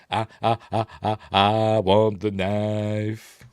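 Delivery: stuttered ahs into very slow drawl; imitated film bit. Doing his bit from the film, exaggerated slow drawl. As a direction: stutter the start, then stretch each word. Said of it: "This is where he does the".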